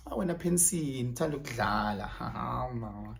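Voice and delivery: low, soft coy voice fading out; flirty tease. Retold or imitated. Imitating a woman teasing a man, soft and low. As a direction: purr it teasingly.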